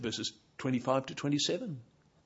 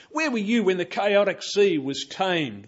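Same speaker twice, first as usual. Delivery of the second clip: raised, mocking challenge; sarcastic rebuke; 6 semitones higher, 7 dB louder than usual. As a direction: taunt with the question. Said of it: "full of sarcasm and rebuke".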